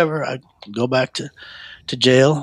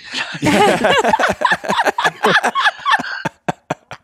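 real high-pitched burst of laughter. Real, not acted. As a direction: let a high laugh ring out. Real laugh after punchline.

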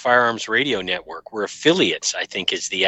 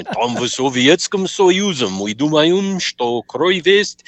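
slow, high cartoon Russian villain voice; hammed-up cartoon Russian spy; Boris Badenov Russian; 6 semitones higher, 5 dB louder, slower than usual. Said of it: "I would just imitate a cartoon character".

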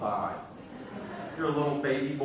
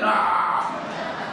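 mock battle yell; 3 semitones higher than usual. Mock gamer charging in.

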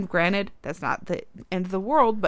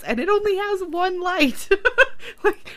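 squeaky high laugh breaking through the words; giggling too hard to finish the sentence. Real, not acted. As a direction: laugh helplessly through the line. Laughing while retelling a silly argument.

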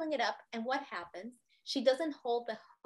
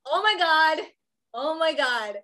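alarmed; 4 semitones higher, 8 dB louder than usual. Retold or imitated. Husband wakes in alarm.

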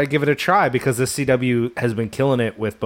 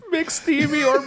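high, drawn-out mock echo; 12 semitones higher, slower than usual. Teasing friend over Grey's Anatomy, high mocking echo. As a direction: tease it out slowly, way up high.

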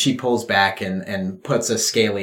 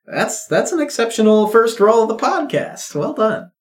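much higher, delighted praise; excited praise; 13 semitones higher than usual. Real, not acted. Game master praising a great roll, high excited voice. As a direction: cheer the roll on.

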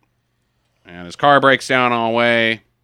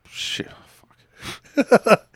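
whispered swear under breath; whispered curse; 10 semitones higher than usual. Real, not acted. Stumbles over a line, swears.

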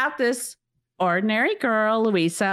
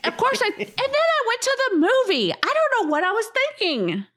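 much higher, fast, rueful disbelief; incredulous at herself; 12 semitones higher, faster than usual. Real, not acted. Telling a co-host she still went to the movie, high and fast in disbelief. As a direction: shake your head at your own choice.

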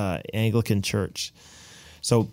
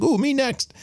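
eager tourists; 13 semitones higher than usual. Retold or imitated. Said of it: "German tourists are like".

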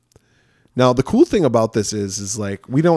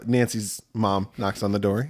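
ordinary talk

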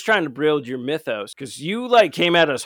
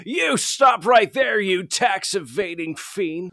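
slow, booming hero command; heroic shout; 4 semitones higher, slower than usual. Pretend. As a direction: call out the villain like a superhero.

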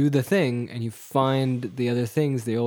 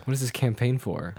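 ordinary delivery

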